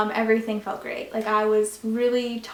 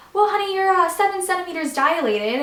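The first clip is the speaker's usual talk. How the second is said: nurse imitation; 8 semitones higher, 3 dB louder than usual. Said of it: "she's like".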